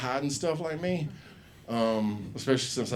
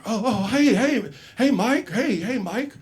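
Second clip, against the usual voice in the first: high, louder, nervous laughing greeting; nervous farmer greeting; 10 semitones higher, 4 dB louder than usual. Retold or imitated. Farmer nervously greeting the biker dad, higher and louder. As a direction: greet him fast, a little scared.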